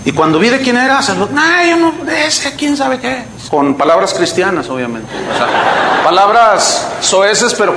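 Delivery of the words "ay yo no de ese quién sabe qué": high-pitched imitation of scornful rejection; mock disgusted refusal. Retelling a listener rejecting the preacher's disc, mock disgusted. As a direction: act out waving it away, pitched well up.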